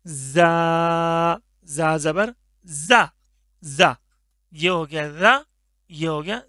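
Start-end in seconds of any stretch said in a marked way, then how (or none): 0.1-4.0 जा said firmly with a whistling hiss; a hard, hissing जा; crisp whistled जा. Shown as a demonstration. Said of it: "इसको सख्ती के साथ पढ़ेंगे".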